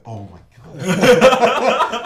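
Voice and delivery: drawn-out, loud, laughing oh my God; shocked. Retold or imitated. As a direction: stretch it out, stunned and laughing. Shocked fan asks about YouTube.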